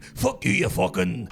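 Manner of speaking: slow, loud put-on dwarf snarl; gruff dwarf voice. Dwarf player cursing an enemy character, gruff put-on voice. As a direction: spit it out like a battle-mad dwarf. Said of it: "'cause I put in the voice on".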